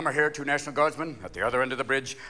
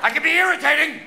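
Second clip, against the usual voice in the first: mock hijacker; 9 semitones higher than usual. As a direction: shout demands like an absurd hijacker. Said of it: "you're gonna go".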